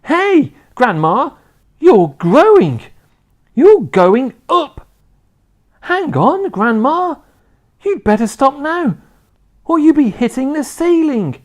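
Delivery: put-on high, loud, urgent boy's shout; alarmed shout. A narrator's reading. A boy warning his growing grandma, shouting. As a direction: yell a warning up at her. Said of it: "gave a jump and shouted".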